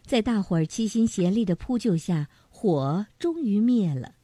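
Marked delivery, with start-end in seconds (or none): none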